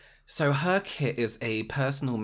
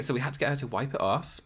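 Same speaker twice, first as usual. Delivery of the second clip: usual speaking voice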